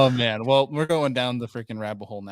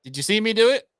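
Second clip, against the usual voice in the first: mock angry retort; 7 semitones higher, 5 dB louder than usual. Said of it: "And I'm like".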